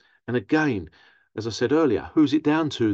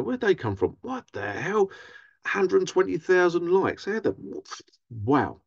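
mock baffled viewer; 8 semitones higher than usual.

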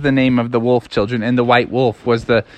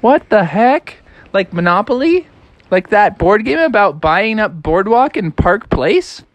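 higher, incredulous questioning; baffled Froggy; 7 semitones higher than usual. Acted. Froggy questions wolf about board game, baffled. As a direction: puzzle aloud with rising questions. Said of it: "And Froggy's like".

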